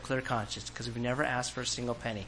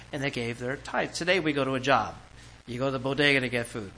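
usual speaking voice

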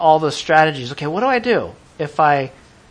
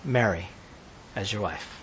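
"Take Mary as your wife" fading softer word by word; soft quoted angel; 8 semitones lower, 7 dB quieter than usual. Acted. Angel telling Joseph to take Mary, fading softer. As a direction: let the angel's words drop to near hush. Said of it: "And he says".

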